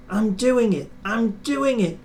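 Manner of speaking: high, breathless eager cry; panting excitement. A narrator's reading. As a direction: pant it out, straining upward. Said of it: "he panted".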